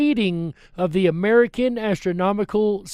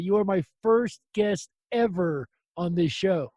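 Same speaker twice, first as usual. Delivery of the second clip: ordinary talk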